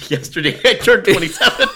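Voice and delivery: giggling at own mistake; real laugh breaking in. Real, not acted. Laughs at own birthday mix-up.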